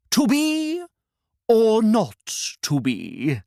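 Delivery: dramatic stage recitation; Shakespearean actor. Acted. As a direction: declaim it like a ham actor. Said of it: "recited a Shakespearean monologue".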